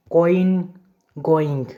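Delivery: कॉइन, गोइंग each set apart, ओ moving into ई; vowel glide demo. Shown as a demonstration. Teacher reads example words, pronouncing कॉइन, गोइंग with an ओ-ई glide. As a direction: sound ओ then ई in कॉइन and गोइंग. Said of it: "जैसे ओ ई".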